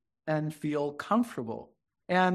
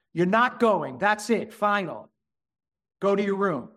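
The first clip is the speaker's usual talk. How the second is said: louder, raised-pitch imitation of a parent's orders; tyrannical parent; 3 semitones higher, 4 dB louder than usual. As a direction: lay down the law like a mock tyrant. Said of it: "It was mostly brought up in tyranny".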